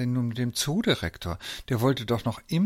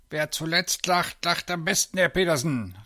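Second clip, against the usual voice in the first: put-on voice, pitched high; triumphant call; 7 semitones higher than usual. A narrator's reading. Zoo director calls out to Herr Petersen, triumphant. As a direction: crow it at your rival. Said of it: "rief er".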